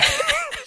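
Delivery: very high, soft squeal of laughter; can't hold it in; squeaky laugh. Real, not acted. Cracking up mid-retelling.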